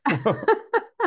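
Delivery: high rising laugh; short, high burst of laughter; real laugh. Real, not acted. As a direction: laugh it off warmly. Laughing at the language mix-up.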